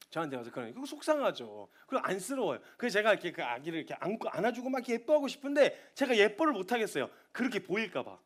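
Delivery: ordinary delivery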